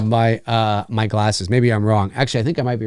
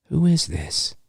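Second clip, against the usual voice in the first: normal but sinister; 3 semitones higher, 6 dB quieter, slower than usual. Retold or imitated. Host imitating Ghostface asking who this is, softly sinister. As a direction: keep it almost normal, with a touch of threat. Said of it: "It's like".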